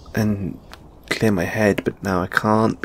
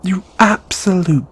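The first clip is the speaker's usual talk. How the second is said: higher, emphatic mock scolding; playful indignation; 5 semitones higher, 4 dB louder than usual. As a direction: sputter insults at a teasing friend. Indignant at being pranked.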